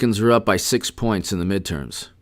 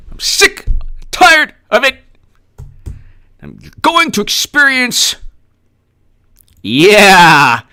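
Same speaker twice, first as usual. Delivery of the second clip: mock crying; 7 semitones higher, 5 dB louder, slower than usual. Mock breakdown.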